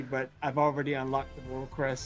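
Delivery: ordinary talk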